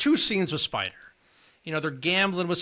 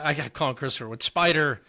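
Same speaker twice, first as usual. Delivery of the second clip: ordinary talk, nothing marked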